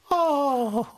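high breathy mock swoon, oh; swooning girls. Host imitating girls swooning over an accent, high mock sigh. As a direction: sigh like a smitten schoolgirl, then laugh. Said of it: "they flock to you and they say".

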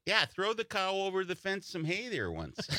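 high Wisconsin German-style phrasing; Wisconsin accent. Retold or imitated. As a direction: imitate an old Wisconsin farmer. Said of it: "but it's with German".

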